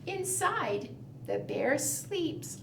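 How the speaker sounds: ordinary talk, nothing marked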